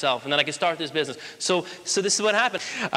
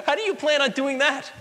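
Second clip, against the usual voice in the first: higher-pitched, scoffing laugh into the question; mocking laugh; 8 semitones higher than usual. Interviewer laughing at Schwarzenegger's Hollywood plan, mocking and incredulous. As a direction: imitate someone laughing in his face. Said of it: "The interviewer laughed in his face and said".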